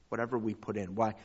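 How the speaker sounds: usual speaking voice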